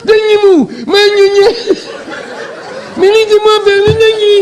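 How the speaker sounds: Romeo squeezed by the railing; high strangled mock Romeo.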